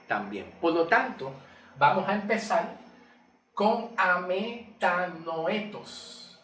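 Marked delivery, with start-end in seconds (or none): 3.5-6.4 "ametanoetos" stretched out very slowly; Greek word demonstration. Shown as a demonstration. Preacher announcing the Greek word to begin with, "ametanoetos" drawn out. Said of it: "es, se traduce".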